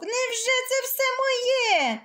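high, delighted wolf voice; wolf overjoyed. Acted. Wolf in the sheep pen, delighted by all the sheep. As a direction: gasp at the feast in glee. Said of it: "зрадів вовк".

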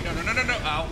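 ordinary talk

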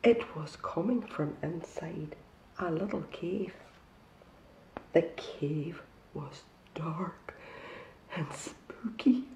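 slow, hushed spooky whisper. A narrator's reading. Storyteller whispering to the children about the cave.

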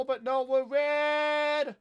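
slow, stretched-out exclamation of surprise; surprised drawn-out cry. Real, not acted. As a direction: drag out the realisation that you're on red. Team colour surprise in game.

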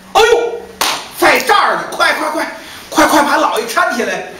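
high startled cry then hurried orders; alarmed cry. Acted. Servants find the master under the bed, startled. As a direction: shout them to lift the master up.

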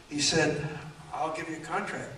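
usual speaking voice

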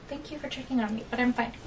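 ordinary talk

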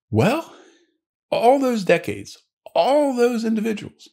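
put-on high, drawn-out old-timer punchline; old New England man. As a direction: pitch it way up as an old local man, easing down at the end. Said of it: "the guy behind the counter, the old New England guy".

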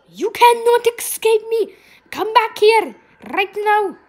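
high, loud playful threat; commanding yell. Pretend. Player chasing escaping victims, playful commanding yell. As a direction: shout after fleeing prey.

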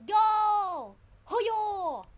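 loud, high battle cry; attack shout. Real, not acted. Player firing off a game move, attack shout. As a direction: yell it out as you strike.

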